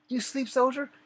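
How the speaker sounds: loud, high barked question; drill sergeant yell. Retold or imitated. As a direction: bark it like a drill sergeant catching a sleeper. Drill sergeant barking at sleepy recruit.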